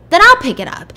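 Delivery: angry retort. Retold or imitated. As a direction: throw it back at her in anger. Husband snaps during car quarrel.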